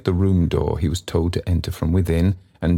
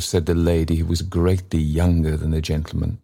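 ordinary delivery

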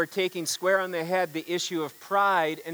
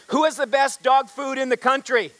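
higher, louder rally call; rousing speech question; 5 semitones higher, 4 dB louder than usual. Acted. Company president firing up salesmen at the dog food convention, rally shout. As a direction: fire up the salesmen. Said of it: "he says".

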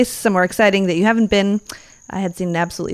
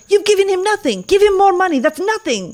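put-on Moroccan accent; 11 semitones higher than usual.

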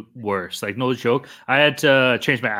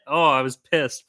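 ordinary delivery

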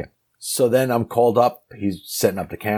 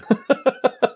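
laughing up high at his own joke. Real, not acted. Laughs after mocking a lazy director, high laugh. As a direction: burst out laughing.

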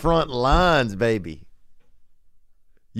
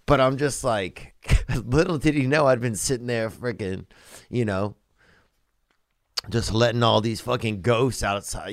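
normal talking voice

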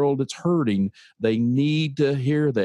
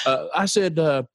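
normal talking voice

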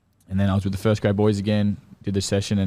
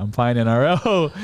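slower, higher, drawn-out announcement; proud announcement; 4 semitones higher, 4 dB louder than usual. Retold or imitated. Player repeats his news to dad on the call, proud announcement. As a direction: say it again slowly, pitched up.

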